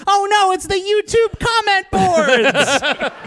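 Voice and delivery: very high, drawn-out panicked wail; mock alarm. Movie hero facing a silly hurdle, high mock-panicked wail. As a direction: wail it in hammed-up horror.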